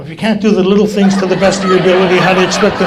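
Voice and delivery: loud, rapid mock scolding; parroted lecture. Now scolding the new guy setting chairs, loud parroting. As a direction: belt out the old-timer's line for laughs. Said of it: "I'm going behind him".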